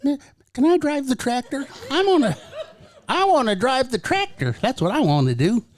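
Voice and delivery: put-on high, pleading little boy whine; eager kid voice. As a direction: beg for the tractor like an excited child. Pretending to want tractor job.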